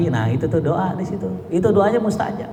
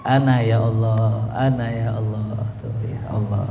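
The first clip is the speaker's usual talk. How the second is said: savoured dhikr; 5 semitones lower than usual. Shown as a demonstration. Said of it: "Jadi dinikmatin tuh".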